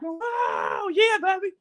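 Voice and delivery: put-on high shouted wow, yeah baby; joyful whoop. Imagining running free on a beach, shouting with high joy. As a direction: cry out with arms thrown open.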